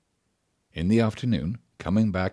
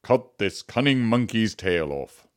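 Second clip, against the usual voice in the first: stern judge; 4 semitones higher, 4 dB louder than usual. Acted.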